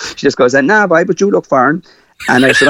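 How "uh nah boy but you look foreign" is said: higher-pitched imitation of a Mayfield accent; quoting her in a Mayfield accent. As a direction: lift the voice into a local Mayfield accent. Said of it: "her nice Mayfield accent".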